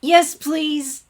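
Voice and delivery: high, loud pleading; begging. Acted. Twilight begs Sunset to take the bag, pleading loudly. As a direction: beg for relief. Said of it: "begged the other girl".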